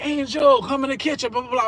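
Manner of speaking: imitating a cook calling him rudely; retold yell. Cook yelling his name from the kitchen, mock yell. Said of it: "don't even yell my name like that".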